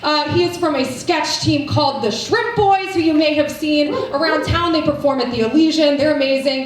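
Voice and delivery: ordinary delivery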